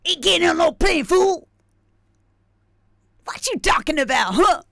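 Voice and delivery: loud, high, tough-guy growl of a catchphrase; Mister T. Retold or imitated. Streamer doing his inner voice for chat, loud and tough. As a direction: bark it like a streetwise tough guy. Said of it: "like Mister T".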